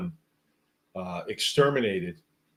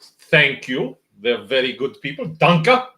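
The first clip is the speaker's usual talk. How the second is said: hammed-up German accent, higher and louder, Danke rising; mock German officer; 5 semitones higher, 7 dB louder, slower than usual. Movie German officer thanking then demanding papers, mock German accent. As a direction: play the film Nazi officer in a mock German accent, slow on Papers, please. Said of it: "throw a danke in at the end".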